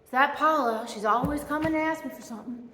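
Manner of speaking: a hint of a weary, put-upon grumble; lightly voiced, muttering dismissively under his breath; annoyed mock God. Preacher imitating an imagined exasperated God, mild mock annoyance. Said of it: "he's never like".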